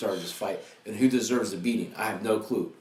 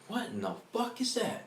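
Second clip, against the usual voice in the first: high startled exclamation; shocked; 12 semitones higher, 5 dB quieter than usual. Retold or imitated. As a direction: blurt it out in shock, pitched up. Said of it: "I'm like".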